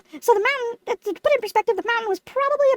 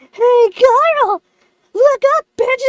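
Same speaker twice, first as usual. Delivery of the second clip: loud, raised holler; calling down; 3 semitones higher, 7 dB louder, much slower than usual. Retold or imitated. Lemon atop the mountain hailing Carl, loud calling. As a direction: yell it gloatingly to someone far below.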